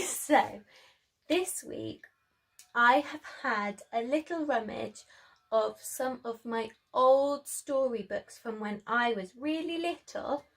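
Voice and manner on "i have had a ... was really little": ordinary delivery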